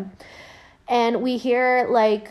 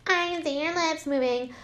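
higher-pitched taunting little heckler; heckling brat; 5 semitones higher, 4 dB quieter than usual. Retold or imitated. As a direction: put on a high voice to needle the performer. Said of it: "that little shit that was like".

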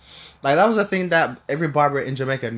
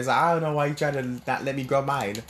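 usual speaking voice

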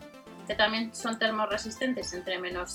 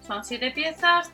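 usual speaking voice

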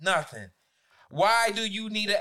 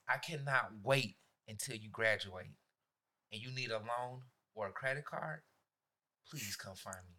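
lower, softer sinister promise; gloating threat; 9 semitones lower, 9 dB quieter than usual. Retold or imitated. Teacher taunting students about future loans, softly sinister. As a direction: savour the revenge quietly. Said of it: "I say".

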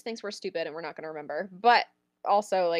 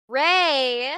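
calling out; 5 semitones higher, 8 dB louder than usual. Retold or imitated. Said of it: "So I go".

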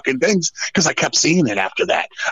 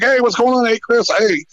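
put-on higher, quick, cheery hello to an old friend; friendly greeting; 8 semitones higher than usual. Greeting Chris backstage after years, lightly acted cheery hello. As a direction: bounce in brightly, glad to see him.

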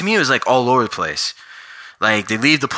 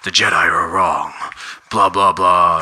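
lightly voiced grumbling old hermit, a bit lower; mock-grumble like a grouchy old Jedi; complaining Luke; 4 semitones lower than usual. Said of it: "he's just complaining".

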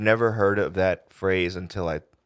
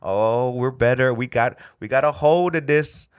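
mildly cocky quote, pitched up; a little arrogant; 4 semitones higher, 4 dB louder than usual. Retold or imitated. Comic retelling how they felt beating the virus, mildly arrogant. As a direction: imitate a touch of overconfidence, higher. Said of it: "we're getting a little arrogant".

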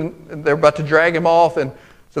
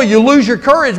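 ordinary talk

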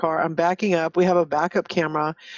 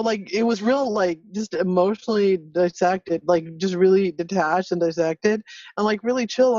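ordinary talk, nothing marked

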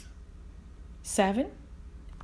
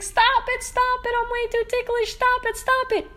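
high squealing dinosaur voice pleading; much higher ticklish T-Rex voice; giggly ticklish dinosaur; 11 semitones higher, faster than usual. Acted. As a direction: beg through the tickles, high up. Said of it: "laughing hysterically".

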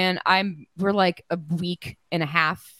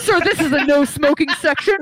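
flight attendant; 8 semitones higher, 5 dB louder than usual. Acted.

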